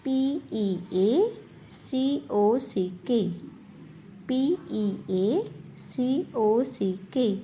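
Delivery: each letter drawn out slowly; slow spelling dictation. Shown as a demonstration. Teacher spelling peacock twice for the class, slowly. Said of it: "peacock की spelling है".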